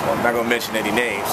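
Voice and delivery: ordinary delivery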